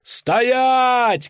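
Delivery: high, drawn-out halting cry; shout. A narrator's reading. Rider bursting onto the square to stop the execution, shouting. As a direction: call out high to stop everything. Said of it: "крикнул наездник".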